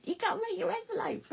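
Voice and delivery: high, bossy mock telling-off; Jeanette voice. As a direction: nag like a strict friend reminding you. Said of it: "Jeanette comes at me going".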